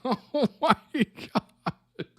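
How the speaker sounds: high, drawn-out words dissolving into laughter; helpless laughter. Real, not acted. Viewer reacting to a hilarious snoring confession, laughing helplessly. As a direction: laugh until nearly crying, stretching the words out. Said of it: "I'm almost crying".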